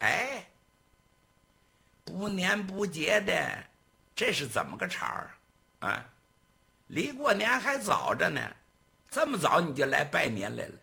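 high, sneering interrogation; bullying gang boss. Acted. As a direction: needle him with mock surprise. Gang boss questions servant.

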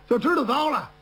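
usual speaking voice

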